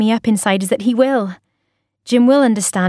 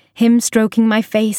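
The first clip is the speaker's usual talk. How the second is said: plain reading voice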